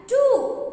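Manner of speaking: teacher stressing frogs live on land too; emphatic too. Shown as a demonstration. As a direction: jump up high on too. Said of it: "do you know, children".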